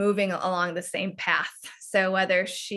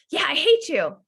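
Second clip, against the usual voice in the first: put-on higher, louder sulky child; playing the angry little girl, pitched up; 8 semitones higher, 4 dB louder than usual. Shown as a demonstration. Mom voices her angry daughter in a lesson, as a higher, louder sulky child.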